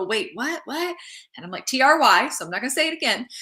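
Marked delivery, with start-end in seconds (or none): 0.0-1.0 mock confusion, rising into a higher question; confused questioning. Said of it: "people go". 1.7-2.3 T R Y spelled out louder and higher. Retold or imitated. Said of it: "when I spell it".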